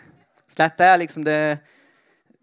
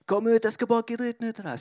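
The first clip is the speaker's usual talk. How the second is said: high-pitched threatening call; mock yell; 9 semitones higher than usual. Kid outside threatening to beat up Rodrigo, mock yell. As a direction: imitate a kid hollering from outside, voice held high. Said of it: "Det er noen utenfor som roper på han".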